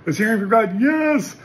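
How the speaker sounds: high, loud, rising to a whoop on Yes; excited cheer. Real, not acted. Preacher cheering the young man who heard from God, high and loud. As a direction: celebrate like your friend's prayer came true.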